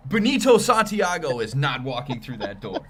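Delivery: booming announcer proclamation; loud mock announcement. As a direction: call it out to the crowd. Running gag, mock announcement.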